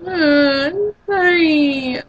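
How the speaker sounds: high, drawn-out mock sob; whining. Mocking a boyfriend punched in the arm, whining. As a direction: draw it out like a whimpering crybaby. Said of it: "start whining".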